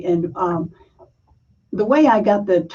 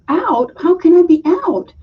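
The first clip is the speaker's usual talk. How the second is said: indignant protest; 10 semitones higher, 5 dB louder than usual. Retold or imitated. Child protests umpire's call.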